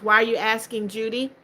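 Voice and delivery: ordinary talk, nothing marked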